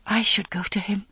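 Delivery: breathy inner voice, hushed; whispered thought. A narrator's reading. Morgaine thinking to herself, whispered. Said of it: "Morgaine thought".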